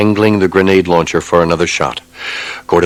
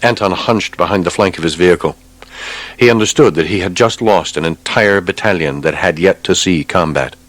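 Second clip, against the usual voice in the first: plain reading voice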